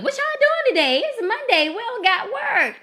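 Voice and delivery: high, eager asking voice; high imitated friends asking about plans; high imitation of friends. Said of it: "hitting us up like".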